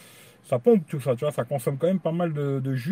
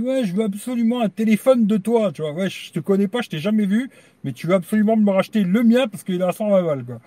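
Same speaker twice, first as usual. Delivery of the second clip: mock-eager quote, higher and louder; imitating a pushy buyer; 5 semitones higher, 5 dB louder than usual. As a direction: ape the stranger's greedy insistence. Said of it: "un mec qui m'a dit". (imitation d'un acheteur insistant, plus aigu et plus fort)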